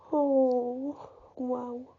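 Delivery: soft, sighed reaction, overcome; moved, trailing away very quietly; real emotion. Real, not acted. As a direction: breathe it out softly, deeply moved. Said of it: "I'm getting emotional".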